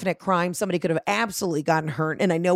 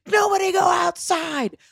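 much higher, slow, frantic shout; hammed-up psycho yell, pitched way up; 12 semitones higher than usual. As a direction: bellow the warning like a lunatic. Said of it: "like a psycho".

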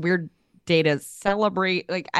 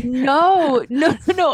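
high laughing protest, no no no; louder and higher, laughing through the words; 10 semitones higher, 9 dB louder, slower than usual. Real, not acted. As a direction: laugh it off, brushing away the worry. Laughing protest at friend's flowers.

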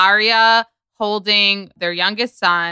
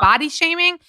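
higher, incredulous question; retold incredulity; 7 semitones higher than usual. Scoffing at people offended by a joke, incredulous. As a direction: toss the phrase back as absurd, pitched up.

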